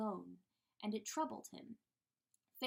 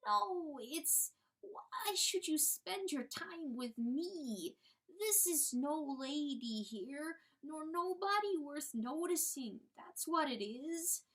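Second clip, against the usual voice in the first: higher, slow, pleading hen voice; timid little hen; 6 semitones higher, slower than usual. Acted. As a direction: play the humble little hen begging. Hen voice continues.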